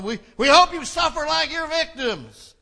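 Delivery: loud, high protest yell; vengeful slogan shout. Acted. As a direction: shout it at the condemned from a crowd. Acting out hostile protest signs.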